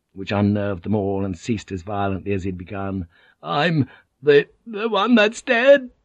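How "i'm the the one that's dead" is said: high, loud, hysterical outburst; hysterical shriek. A narrator's reading. Doomed Vink announcing his own death, hysterical shriek. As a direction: shriek it wildly, stretching the words. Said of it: "Vink shrieked with laughter".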